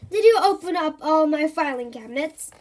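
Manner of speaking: loud, cross monster voice; accusing monster. Acted. Monster glaring at Jill over cabinets. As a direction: accuse her like an angry monster.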